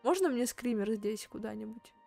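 usual speaking voice

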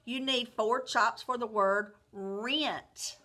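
normal talking voice

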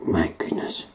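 whispered aside. Real, not acted.

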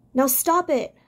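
firm. A narrator's reading. Hazel firmly stops Fiver's panic, firm command. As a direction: cut him off with a firm order. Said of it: "said Hazel firmly".

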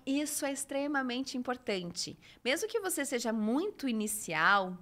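usual speaking voice

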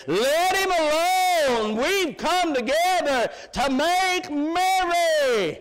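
high, loud, slow father's ruling; father's proclamation. Acted. Father telling the elder brother to let him be, loud slow declaration. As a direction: lay down the law with joy, slowly.

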